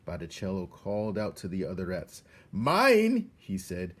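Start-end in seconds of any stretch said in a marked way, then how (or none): 2.5-3.3 high, loud greedy claim; possessive rat. Acted. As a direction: stake your claim loudly. Botticelli claims prey.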